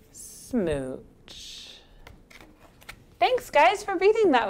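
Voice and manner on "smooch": low, soft, drawn-out smooch; final smooch. Acted. Fish gives the closing kiss, low lingering smooch. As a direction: let the last kiss drop low and soft.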